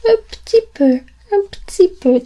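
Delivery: un petit peu said casually, petit squeezed to ptit; clipped colloquial petit; everyday French. Shown as a demonstration. Teacher models the everyday sound. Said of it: "People tend to not pronounce it petit".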